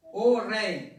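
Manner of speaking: solemn address. A narrator's reading. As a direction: call out to the king with reverence.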